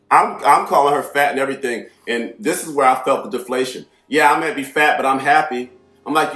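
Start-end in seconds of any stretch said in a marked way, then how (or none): 4.1-5.7 higher, louder mock comeback; imitated defensive woman. Woman answering back after being insulted, defensive mimicry. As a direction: mimic her defiant self-defence.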